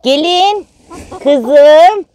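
high, loud fond call. Real, not acted. Calling the last hen, the bride, high and fond.

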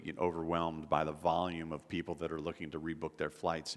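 ordinary delivery

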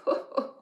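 short sly chuckle; a quick knowing chuckle; fox chuckle. Acted. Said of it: "The fox chuckled".

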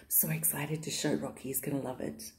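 unvoiced, secretive whisper. Real, not acted. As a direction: whisper so the cat won't hear.

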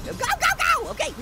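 high frantic urging; urgent panic. Real, not acted. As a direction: urge yourself on frantically. Urgent fleeing.

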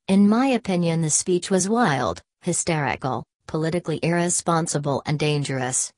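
usual speaking voice

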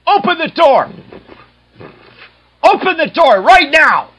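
bang-on-the-door angry yell; angry shouting. Acted. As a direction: yell it, furious and loud. Angry man yelling at kids.

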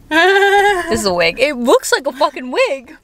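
high, loud, slow disbelief; shocked. Real, not acted. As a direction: gasp it out, stunned. Shocked at friend's haircut.

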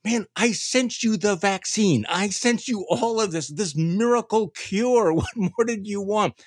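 high, slow, exasperated Jesus voice. Acted. Jesus scolding people who refuse the vaccine, high exasperated mock voice. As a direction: lay it out like a fed-up savior, a laugh breaking in. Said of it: "Jesus is going".

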